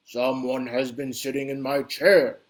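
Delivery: a big bear bellowing at his chair; bellowing Papa Bear. Acted. Papa Bear finds his chair moved, loud low bellow. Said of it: "he bellowed in an even bigger Papa Bear voice".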